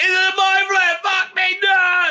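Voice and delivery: high, loud panicked shout; panicked yell. Acted. Acting out the captive facing the mind flayer, hammed-up panicked yell. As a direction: shriek it out in mock terror.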